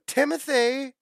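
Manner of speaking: high, loud sing-out of the name; imitating friend's mom. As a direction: call out like a mother summoning her son. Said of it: "try and mimic his mom's voice".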